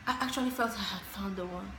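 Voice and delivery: soft, low and let down after a sigh; sigh, then repeat it quietly to yourself; wistful sigh. Acted. Repeating the lost hope to a friend, wistful.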